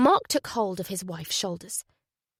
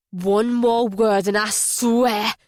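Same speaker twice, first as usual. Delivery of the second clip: slow, menacing warning; threatening; 6 dB louder, slower than usual. Acted. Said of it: "let the threat hang".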